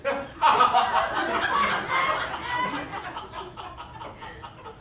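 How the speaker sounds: high, real laughter. Real, not acted. Laughing while telling the audience about his resume trick. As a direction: crack up at the memory.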